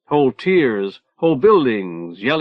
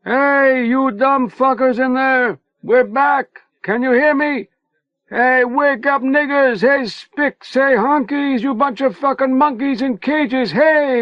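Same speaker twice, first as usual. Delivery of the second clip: high, loud taunting yell; bellowed insults at the prisoners; yelling at the wall; 13 semitones higher, 3 dB louder, slower than usual. A narrator's reading. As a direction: holler over the prison wall through cupped hands. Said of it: "cupped his hands over his mouth and yelled".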